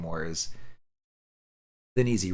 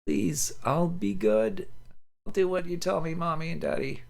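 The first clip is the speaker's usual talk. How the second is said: put-on pleading child; 9 semitones higher than usual. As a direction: beg like a small child trying to be good. Delirious child begging her parents.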